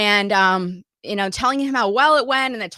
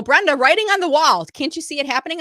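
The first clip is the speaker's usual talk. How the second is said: louder, higher mock warning to Brenda; imagined critics; 7 semitones higher, 7 dB louder than usual. Imagined people warn Brenda of layoffs, louder mock call. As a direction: play the doubters calling out a warning. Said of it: "some people might go".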